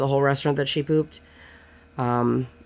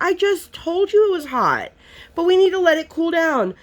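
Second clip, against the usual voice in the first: high, raised exasperated mom voice; 14 semitones higher than usual. Retold or imitated. Mom telling daughter to let food cool, high exasperated scolding. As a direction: scold her like a fed-up mother.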